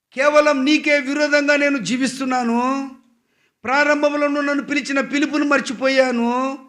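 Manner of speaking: high, loud anguished prayer to God; weeping prayer. Retold or imitated. David weeps before God, pouring out a high, loud lament. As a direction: cry out to God in grief, pitched high. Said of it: "అని ఏడుస్తున్నాడు".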